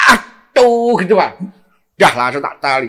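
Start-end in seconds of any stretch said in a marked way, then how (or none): 0.1-0.9 loud, high "atuh" with a long held vowel; drawn-out mock scream; imitated pain scream. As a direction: mimic a man howling in pain. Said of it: "Teriak, Pak".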